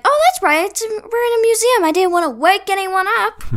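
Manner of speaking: high, loud imitation of a yeller; mock yelling. Acting Candace told off for yelling.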